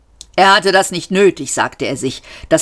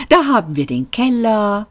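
slow, high, sugary lift voice; honeyed; 5 semitones higher than usual. A narrator's reading. Lift lists other places to go, honey-sweet. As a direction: draw each item out like dripping honey. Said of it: "wie Honig auf ein Brötchen".

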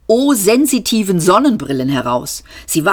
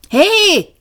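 loud, much higher shout; alarmed call into empty air; 14 semitones higher, 4 dB louder than usual. Acted. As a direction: shout it to a voice you can't see. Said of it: "rief Seifert".

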